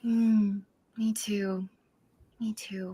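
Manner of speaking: soft whispered agreement. Acted. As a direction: whisper back quietly. Shopkeeper whispers back.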